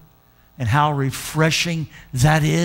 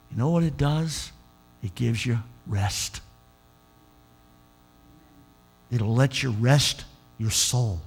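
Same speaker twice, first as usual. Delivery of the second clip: soft sermon reflection; 3 semitones lower, 4 dB quieter than usual. Acted. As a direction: linger gently on the rest. Preacher's soft reflective passage.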